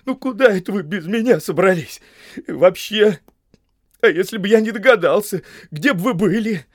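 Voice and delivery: high, loud, plaintive reproach; weepy scolding; tearful voice. A narrator's reading. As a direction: wail it like a hurt, crying servant. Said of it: "плачущим голосом проговорил Сэм".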